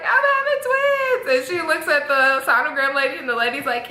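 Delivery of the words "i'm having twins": very high teary wail; half-sob it to your cousin; crying out the news. Retold or imitated. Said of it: "And I'm like".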